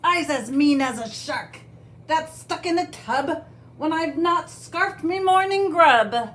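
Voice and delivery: loud, rollicking pirate cry. A narrator's reading. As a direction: call it out after a man overboard. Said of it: "Pete cried".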